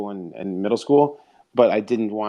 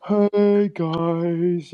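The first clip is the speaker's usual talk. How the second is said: mocking other spaces; 5 semitones higher than usual. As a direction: parody the self-important hosts with a high, bright hello. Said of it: "other spaces being like".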